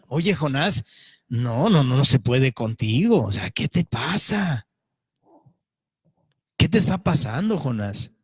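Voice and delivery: exasperated captain chiding Jonah. Acted. Voicing captain's annoyed reproach.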